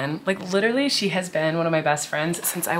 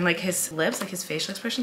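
ordinary talk

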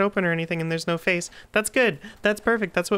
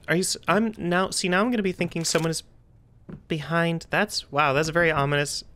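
normal talking voice

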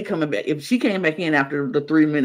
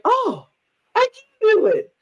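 high-pitched "oh!" of discovery; mock surprised realization; slower than usual. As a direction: squeak it out in mock amazement. Mimics someone surprised at succeeding.

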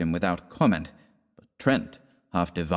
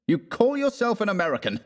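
high-pitched scornful challenge; sneering; 13 semitones higher than usual. A narrator's reading. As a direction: sneer the question, pitched well up. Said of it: "he sneered".

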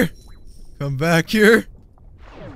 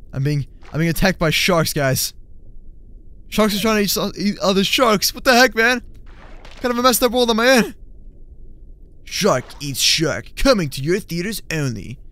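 normal talking voice

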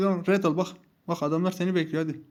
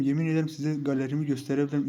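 normal talking voice

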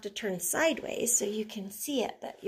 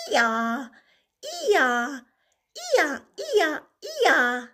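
braying ee-yaw climbing higher and louder; donkey bray; 3 semitones higher, 5 dB louder, much slower than usual. Acted. Singing the donkey's bray for the kids, braying. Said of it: "a funny donkey sound".

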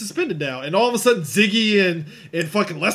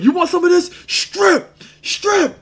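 much higher and louder, barking orders; mock robber yell; 14 semitones higher, 8 dB louder, slower than usual. Acting out the robber in the diner, a high mock yell. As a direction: yell it like a jittery stickup kid. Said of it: "he's like".

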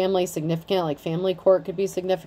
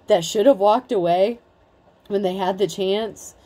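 usual speaking voice

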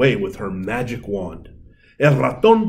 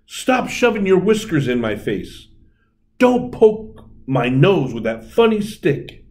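irritated fairy; 6 semitones higher than usual. A narrator's reading. Fairy scolding mouse in tug.

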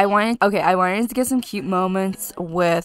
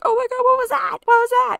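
much higher, panicky mock fright; mock scared; 3 dB louder, faster than usual. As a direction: play the startled little Sim. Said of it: "Quinny's so scared".

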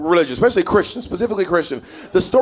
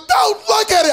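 high shouted warning; panicked mom; 14 semitones higher than usual. Retold or imitated. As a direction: shriek it like a frightened mother. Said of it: "She goes".

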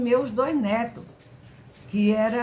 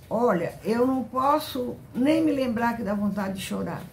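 ordinary talk

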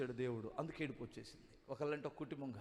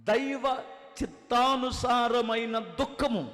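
fiery preaching; 8 semitones higher, 10 dB louder than usual. Acted. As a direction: preach it hard to the congregation. Pastor preaching on weeping.

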